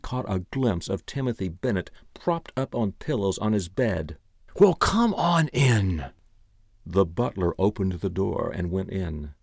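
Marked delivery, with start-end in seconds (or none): 4.6-6.1 slow, high, loud peevish call; petulant. A narrator's reading. Old man in bed calling to his butler, petulant. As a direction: call out irritably, dragging the words in a higher voice. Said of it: "tired voice inside the room said petulantly".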